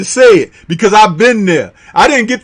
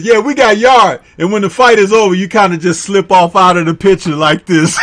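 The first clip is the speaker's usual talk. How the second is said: normal talking voice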